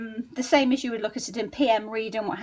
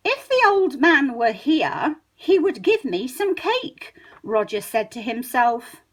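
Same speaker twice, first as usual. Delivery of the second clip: the formal book version, unhurried; slow careful reading; 3 dB louder, slower than usual. Shown as a demonstration. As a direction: read it slowly, every word full. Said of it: "how we would speak on paper".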